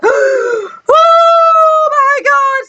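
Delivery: high drawn-out "oh my God"; awestruck outcry. Retold or imitated. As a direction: re-enact a stunned, high-pitched gasp of awe. Reacting to the film's ending.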